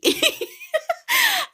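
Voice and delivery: very high, loud squealing laugh; real high-pitched squeal of laughter; shrieking laugh. Real, not acted. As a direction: burst into a shrill giggle. After teasing crush.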